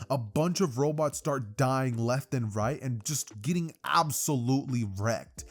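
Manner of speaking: ordinary delivery, nothing marked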